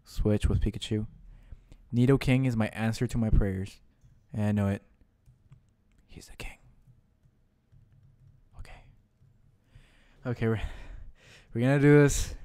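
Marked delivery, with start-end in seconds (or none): 6.1-8.8 barely voiced whisper. Real, not acted. Gamer praising his Nidoking, whispering. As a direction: breathe it reverently.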